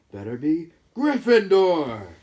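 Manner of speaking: hat booms Gryffindor; hat shouting. Acted. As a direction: announce the verdict to the whole hall. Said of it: "the hat shout the last word".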